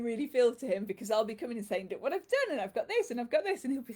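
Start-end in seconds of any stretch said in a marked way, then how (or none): 1.9-3.6 excited showing off. Retold or imitated. Her excited showing off to Adam.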